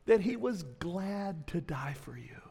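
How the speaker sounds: a tender, breathy confession of love; whisper. Acted.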